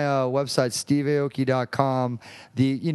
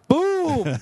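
high, loud triumphant boom; excited boom; 4 dB louder than usual. Real, not acted. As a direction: punch it out like a mic drop. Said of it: "Self-promotion".

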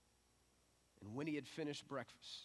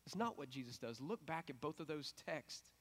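normal talking voice

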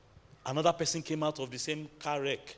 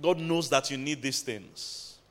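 normal talking voice

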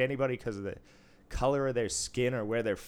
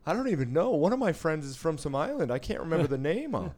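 high, louder mock excuse voice; squeaky defensive parody, higher pitch; 7 semitones higher, 4 dB louder than usual. Mocking defensive excuses, laughter.

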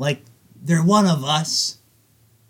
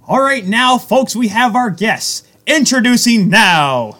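higher, louder, slow hammed-up announcer call; arena announcer; 5 semitones higher, 5 dB louder, slower than usual. Podcast host introducing the guest like an arena announcer. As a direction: boom it out like a PA announcer hyping the team.

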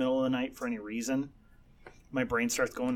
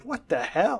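baffled look; 9 semitones higher, 4 dB louder, slower than usual. Retold or imitated. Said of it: "You're just looking at me like".

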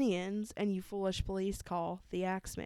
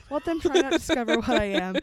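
mock menace; 3 semitones higher, 8 dB louder, faster than usual. Reading the letter in a menacing mock tone.

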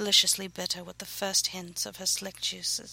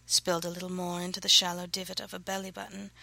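usual speaking voice

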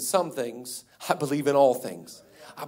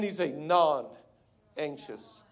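non-anxious calm; 2 semitones lower, 4 dB quieter, much slower than usual. Acted. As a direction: settle right down into total calm. Jesus calm against fretting.

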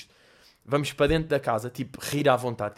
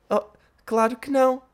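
put-on kid Jake; 8 semitones higher than usual. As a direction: ask shyly like a little boy. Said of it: "o teu pai sabe que estás aqui". (Jake, miúdo tímido, voz fingida)

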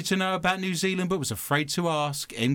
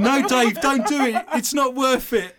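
loud pleading; 8 semitones higher, 3 dB louder than usual. Pretend. Pleading with pretend Dave.

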